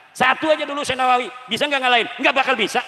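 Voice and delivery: emphatic challenge. Real, not acted. Preacher challenges the audience emphatically.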